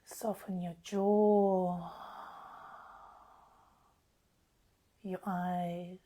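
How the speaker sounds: slow, spacious meditation voice with long pauses; guided meditation. Acted. Healer easing live listeners into relaxation under the full moon, slow guided meditation. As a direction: guide them gently into relaxation, unhurried.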